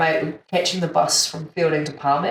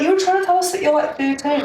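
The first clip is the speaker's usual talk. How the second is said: put-on amused higher girl voice; 11 semitones higher, faster than usual. Girls recount his confusion.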